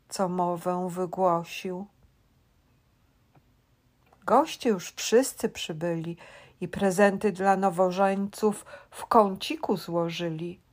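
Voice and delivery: ordinary delivery, nothing marked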